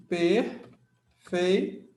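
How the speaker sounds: "Perfeito" split as "per", "fei", "to" with long pauses; syllable by syllable. Shown as a demonstration. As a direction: separate each syllable of "Perfeito", very slowly. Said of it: "Vamos fazer a separação silábica".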